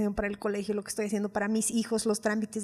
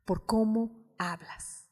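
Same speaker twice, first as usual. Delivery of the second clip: gentle divine voice; 7 dB quieter, slower than usual. Retold or imitated. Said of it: "Y el Espíritu Santo me dijo".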